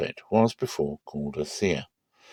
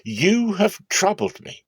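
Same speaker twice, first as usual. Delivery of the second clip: reproachful father; 11 semitones higher, slower than usual. Acted. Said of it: "Jacob said to Simeon and Levi".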